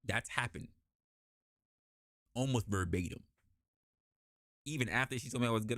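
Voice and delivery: ordinary delivery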